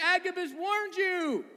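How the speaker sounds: high, drawn-out mock plea; pleading. Friends pleading with Paul about the warning, high acted wail. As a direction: plead in a stretched, high-pitched cry. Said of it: "their pleading".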